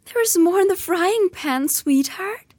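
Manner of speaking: doting aunt voice. Acted. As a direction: coo over a spoiled son. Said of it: "said Aunt Petunia".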